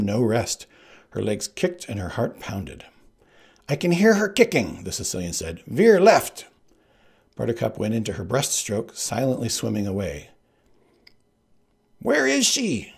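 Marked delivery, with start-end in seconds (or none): 3.7-4.8 much higher, louder; Sicilian's voice. Acted. Sicilian listening for Buttercup, high and eager. 5.7-6.5 high, loud snap; Sicilian's command. Acted. Sicilian steering the boat, high and loud. 12.0-12.9 high shriek. Acted. Sicilian losing her in the dark, shrieking. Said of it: "shrieked the Sicilian".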